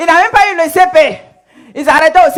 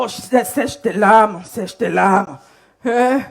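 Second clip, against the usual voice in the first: lower, softer, slow coaxing; seductive comforting; 5 semitones lower, 4 dB quieter, much slower than usual. Acted. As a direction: soothe her like a smooth seducer. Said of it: "il dit, bon".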